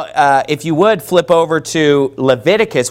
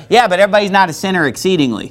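normal talking voice

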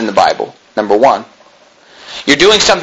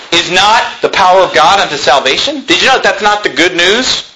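normal talking voice

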